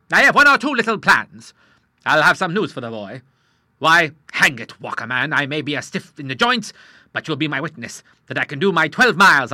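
loud, hearty sea captain; boisterous old admiral. Acted. Admiral telling the doctor he'll go to sea, loud and boisterous. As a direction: bluster through it like an old salt.

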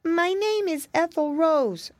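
put-on high, slow little-girl voice; gentle crying voice. A narrator's reading. Lost girl introduces herself, gently tearful. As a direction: give it plaintively, like a lost child. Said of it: "went on the voice".

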